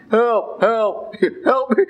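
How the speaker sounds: mock cries for help. Priest acting a man stuck in a well, mock yelling for help. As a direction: call up from the well, hamming it a little. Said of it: "screaming loud and constantly".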